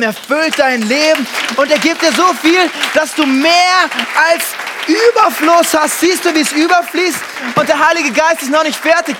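loud, high, driving sermon climax; rousing revival preaching; full-force preaching. Acted. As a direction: preach it to the back row.

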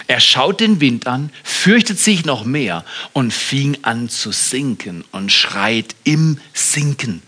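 ordinary delivery

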